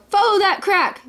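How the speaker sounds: high-pitched urgent command; storybook yell, pitch over volume; mild yell. A narrator's reading. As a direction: call it out high to the driver. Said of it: "He yelled".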